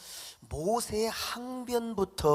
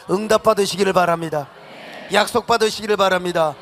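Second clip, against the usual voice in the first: higher and louder, calling out the blessing; fervent preaching shout; 4 semitones higher, 9 dB louder than usual. Real, not acted. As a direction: preach it at full force to the room. Preacher exhorts congregation at full force. (열정적으로 외치는 설교)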